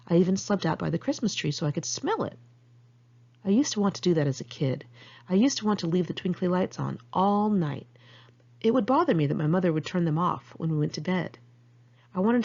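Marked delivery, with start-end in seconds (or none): none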